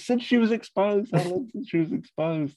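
very high, pressing mock panic; high frantic Liz. Liz pressing Sean in panic. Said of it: "Like that".

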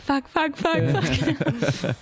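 high, laughing retold panic; mock panic. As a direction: imitate your own flustered inner swearing. Mimes own panic at slipping accent.

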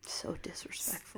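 breathy whispered disapproval; whispered aside. Real, not acted. Friend hearing the boyfriend's text, scandalised whisper.

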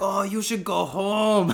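slow, high, loud mock old man; hammed-up scolding advice; old couple telling the lost foreigners to go home; imitating old couple. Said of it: "And they're like".

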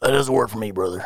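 lightly voiced Hulk Hogan, sinking low at the end; mild wrestler catchphrase trailing off low; Hulk Hogan impression. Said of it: "he would simply go".

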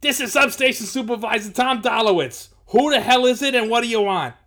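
high, very loud irate bellow. A narrator's reading. Supervisor challenging strangers at the door, bellowing. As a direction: bellow it gruffly through the door. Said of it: "Then some bellowing addressed them".

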